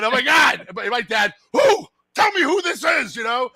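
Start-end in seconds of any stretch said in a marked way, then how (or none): 0.0-0.7 high, loud exclamation of dismay; retold yelling. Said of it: "You know, I'm yelling". 1.5-3.1 very high, loud demand; imitated outraged dad. Voicing dad's outraged demand.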